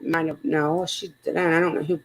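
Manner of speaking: ordinary talk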